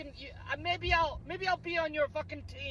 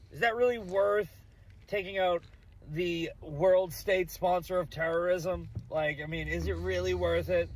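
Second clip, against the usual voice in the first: reading his own post; 7 semitones lower than usual. Retold or imitated. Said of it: "here's what I said".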